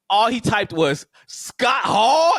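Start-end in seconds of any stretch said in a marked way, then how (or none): 1.3-2.3 loud, high shouted "Scott Hall"; all-caps shout. Retold or imitated. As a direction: read the all caps as a yell. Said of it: "in all caps with question marks".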